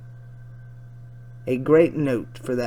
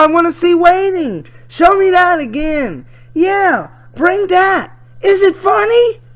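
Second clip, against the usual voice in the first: put-on much higher, louder girlish voice; excited teen girl; 11 dB louder than usual. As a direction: squeal through her chat like an excited girl. Reads chat as excited girl.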